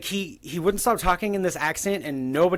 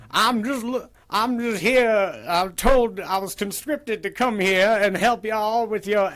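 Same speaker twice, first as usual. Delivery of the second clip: higher-pitched imitated Southern accent; 4 semitones higher than usual. Brother character come looking for him, put-on Southern accent. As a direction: play a sophisticated Southern frog character. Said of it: "that same Southern accent".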